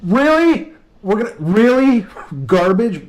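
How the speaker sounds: high, loud disbelieving squawk; a mocking, squeaky high "really?"; incredulous other pilot. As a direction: play the baffled pilot who can't believe it. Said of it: "the reaction of the other pilot".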